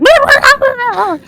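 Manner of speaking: high, loud wordless squeal; creature squeal. Acted. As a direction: squeal like a tiny odd creature. Voicing the pincushion creature.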